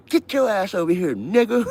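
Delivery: high, loud barking guard; mock guard yell. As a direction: bellow like a prison guard. Guard yelling at inmate.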